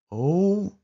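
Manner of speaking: a frightened yelp; startled cry. A narrator's reading. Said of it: "a hushed cry escaped my lips".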